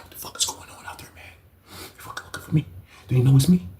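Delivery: hushed, nervous questions; scared whisper. Retold or imitated. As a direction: breathe it out like a scared man. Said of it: "he was talking like he was scared".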